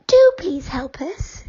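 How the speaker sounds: high, slow, pleading doll voice; high sweet doll voice; Silky, doll voice. A narrator's reading.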